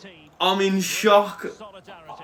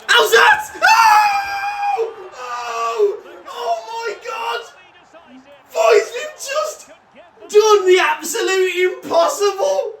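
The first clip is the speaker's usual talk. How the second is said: ecstatic screaming; slower than usual. Real, not acted. Gamer screaming at the winning goal, ecstatic and tearful. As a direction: scream it like your team just won the final. Last-minute playoff winner celebration.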